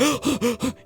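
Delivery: high startled intake of breath; sharp gasp. Retold or imitated. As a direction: gasp in sudden horror. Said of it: "I was like".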